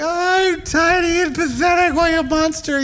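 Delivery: high, slow whiny little-dog voice; pathetic tiny dog voice. Retold or imitated. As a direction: voice the pitiful pup begging. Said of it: "she gives me this, like".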